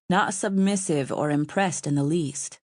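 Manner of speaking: plain reading voice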